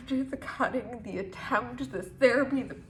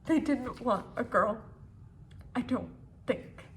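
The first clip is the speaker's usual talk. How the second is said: usual speaking voice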